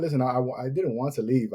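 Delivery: ordinary delivery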